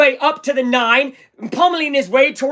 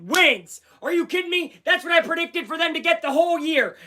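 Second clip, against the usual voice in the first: excited; 5 semitones higher than usual. Real, not acted. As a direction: rave about the win. Post-win hype.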